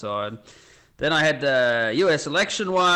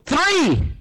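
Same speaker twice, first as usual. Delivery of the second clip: high, sudden shout; high-pitched cadet's outburst; blurted answer; 4 dB louder than usual. Acted. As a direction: blurt it out in a panicked guess. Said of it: "thinking furiously to himself, and he goes".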